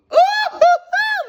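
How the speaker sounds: shrieking burst of laughter; cracking up hard; loud high laugh. Real, not acted. Loud laugh after rant.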